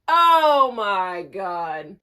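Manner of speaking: loud, long drawn-out groan of words; exasperated exclamation. Retold or imitated. Player learns teammate is crying to coach, exasperated.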